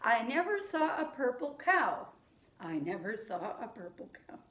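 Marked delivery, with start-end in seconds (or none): 2.6-4.4 quieter echo. Shown as a demonstration. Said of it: "then you say it back to me".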